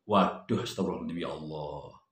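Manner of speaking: low, very soft, trailing into near silence; dismayed sighing invocation. Retold or imitated. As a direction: murmur it under your breath in dismay. Dismayed at the debtor's broken promise. (gumaman lirih penuh kecewa)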